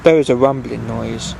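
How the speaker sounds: plain reading voice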